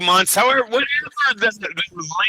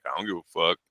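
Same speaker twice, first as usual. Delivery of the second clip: quiet mock fighter; 10 semitones lower, 8 dB quieter, much faster than usual. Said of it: "He's like".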